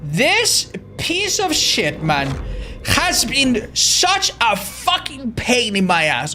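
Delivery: high, dragged out complaining; slow whiny rant. Real, not acted. Streamer cursing the boon totem, slow high whine. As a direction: whine it slowly, fed up.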